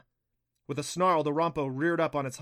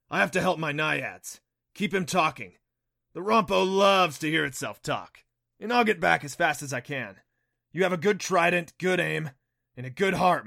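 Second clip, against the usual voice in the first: loud, raised-pitch creature voice; Neff, the kelpie; 4 semitones higher, 8 dB louder than usual. A narrator's reading. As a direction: speak up firmly as a departing ally.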